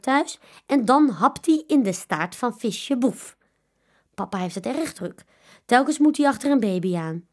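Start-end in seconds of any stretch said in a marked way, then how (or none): none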